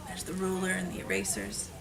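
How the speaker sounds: soft, quick aside; quiet aside. Real, not acted. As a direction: say it under your breath. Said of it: "aside about pictures".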